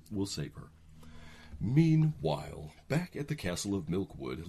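usual speaking voice

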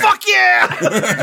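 loud triumphant yell; excited shout. Real, not acted. Cheering the scene.

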